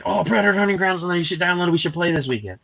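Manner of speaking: high-pitched eager pitch to a pal; retold gamer enthusiasm; imitated eagerness. Imitates friend eagerly proposing game.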